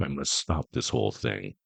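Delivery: low, quiet Grinch muttering to himself; a hushed, scheming Grinch; Grinch grumbling. A narrator's reading. The Grinch plotting alone in the dark, low and quiet. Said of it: "the more the Grinch thought".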